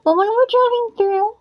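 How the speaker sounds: put-on boy explaining to the stranger, high; kid voice. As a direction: chirp like a small boy. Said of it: "He's like".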